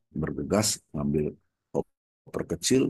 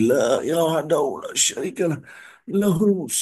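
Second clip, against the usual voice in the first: ceaseless dhikr; 9 semitones higher, 3 dB louder than usual. Retold or imitated. Preacher imitating dying man's endless prayer, ceaseless dhikr. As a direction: recite on and on without stopping. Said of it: "nggak berhenti-berhenti".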